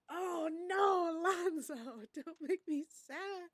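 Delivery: high, loud, slow wail of dismay with a laugh; pleading with the game not to hurt her; upset cry. Real, not acted. Upset at Alonzo's fate, a high pleading wail.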